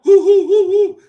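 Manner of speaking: loud, high hooting; mock owl hoots. Reactor teasing the father as an owl, mock excited hooting. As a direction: hoot like an over-excited owl to tease. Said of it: "are you an owl?".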